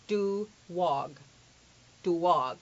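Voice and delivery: "Do wog" as doo-WAWG, in two parts; spelt-out New York sound. Shown as a demonstration. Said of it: "I literally write it out".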